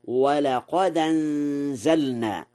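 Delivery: slow, linked ولقد أنزلنا without glottal stop; hamza dropped in ولقد أنزلنا. Shown as a demonstration. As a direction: run ولقد أنزلنا together as walaqadanzalna. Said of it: "بنقل حركة الهمزة".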